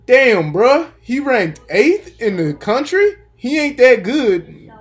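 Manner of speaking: doing a North Carolina crowd guy, scoffing; North Carolina accent. Retold or imitated. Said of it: "That's my North Carolina accent".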